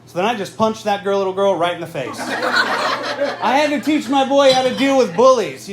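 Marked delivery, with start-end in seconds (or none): 2.2-5.7 shouted punchline. Acted.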